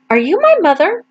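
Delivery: put-on high small voice of a lost baby bird. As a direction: ask it as a tiny hopeful chick. Baby bird asks the hen.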